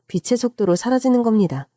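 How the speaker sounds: plain reading voice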